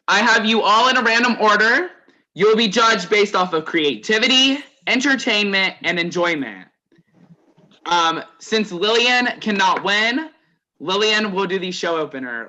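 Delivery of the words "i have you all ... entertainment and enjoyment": loud, higher projected announcer voice; host announcement. Real, not acted. Host explains judging to the contestants, loud announcer style. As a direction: project the rules to every contestant.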